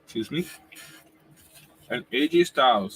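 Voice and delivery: talking through a mouthful of food; mouth full. Real, not acted. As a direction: speak while still chewing. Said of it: "I'm talking my mouth full".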